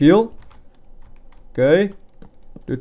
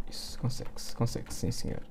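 breathy unvoiced whisper; 4 semitones lower, 14 dB quieter than usual. Real, not acted. Player whispering encouragement while building. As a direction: whisper the cheer to yourself.

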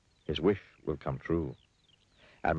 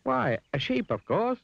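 very high, breezy answer; Mister Dizzy; 5 dB louder, slower than usual. A narrator's reading. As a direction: pipe up as if it were obvious. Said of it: "replied Mister Dizzy".

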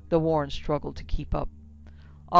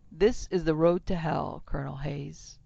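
plain reading voice

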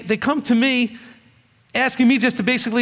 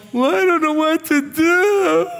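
high, slow, whining mock voice; whiny crying man; 8 semitones higher, 3 dB louder, slower than usual. Preacher mimics a helpless man coming to him, high whine. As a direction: whimper it like a helpless grown man. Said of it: "all crying and upset going".

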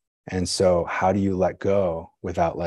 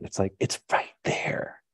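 whispered, slow and hushed; 5 dB quieter than usual. Acted. Teacher to students, marveling in a slow whisper. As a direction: breathe it out like a secret. Longing for obvious nirvana.

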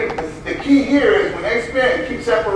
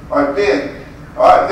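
ordinary talk, nothing marked

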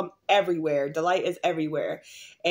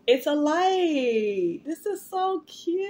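delighted squeal; 8 semitones higher, much slower than usual. Real, not acted.

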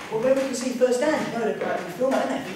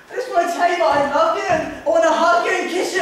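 loud, raised-pitch outpouring; blurted love declaration; 5 semitones higher, 5 dB louder, faster than usual. Acted. Boy declaring love to Linda, loud outburst. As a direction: let the confession burst out loudly.

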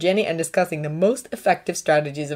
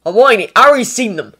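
snapping, louder and higher; a sharp, loud retort; snapped; 4 semitones higher, 9 dB louder than usual. A narrator's reading. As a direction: snap it out impatiently. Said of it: "Weasley snapped".

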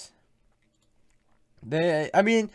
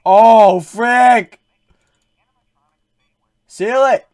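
panicked shout; 7 semitones higher, 15 dB louder, much slower than usual. Real, not acted. Jump scare panic.

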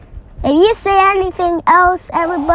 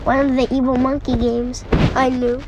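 ordinary delivery

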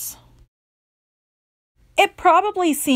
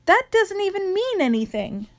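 usual speaking voice

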